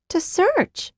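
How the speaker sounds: put-on high, soft surprised child; as if it were obvious; girl surprised he hadn't guessed. A narrator's reading. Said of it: "sounding surprised that he hadn't guessed".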